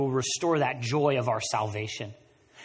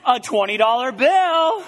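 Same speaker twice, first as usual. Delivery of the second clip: laughing, higher and louder, slowed right down; delighted discovery; 10 semitones higher, 10 dB louder than usual. Pretend. Finding cash in an old coat pocket, delighted and laughing. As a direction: savour the surprise find with a laugh.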